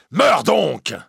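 loud, high, violent curse; furious outburst. Acted. Priest rejected by the girl, furious. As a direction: hurl it out in rage. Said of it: "Il pousse un cri violent".

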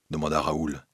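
ordinary delivery, nothing marked

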